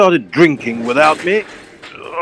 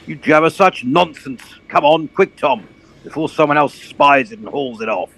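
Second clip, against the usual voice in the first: ordinary talk, nothing marked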